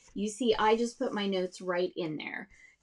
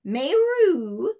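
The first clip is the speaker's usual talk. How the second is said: higher, louder mock cat sound going up; cat hiss; 7 semitones higher, 9 dB louder than usual. Teacher voicing the story's cat going up. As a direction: play the hissing cat. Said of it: "the cat hisses".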